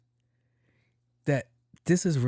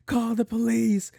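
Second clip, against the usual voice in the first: mock unmanly scream; 9 semitones higher, 3 dB louder than usual. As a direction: imitate a screaming man, very high-pitched. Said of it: "he's screaming. And it's not a manly scream".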